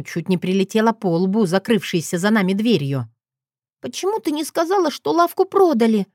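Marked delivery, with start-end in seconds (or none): 3.8-6.1 put-on high-pitched little girl's reproach; sulky child. A narrator's reading. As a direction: ask like a pouting little sister. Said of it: "насупилась Катя". (обиженный детский голос)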